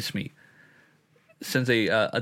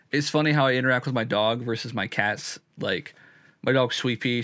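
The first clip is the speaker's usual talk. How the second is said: ordinary talk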